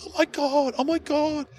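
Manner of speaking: hammed-up high-pitched fangirl gasp; starstruck girl. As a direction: gush like a teen fangirl. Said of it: "other girl goes".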